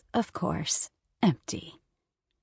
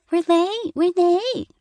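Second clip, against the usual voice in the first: much higher and louder, a child's mocking parrot; mocking high-pitched voice; 12 semitones higher, 7 dB louder, slower than usual. A narrator's reading. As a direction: mimic mom in a squeaky taunt. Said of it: "imitating her high-pitched voice".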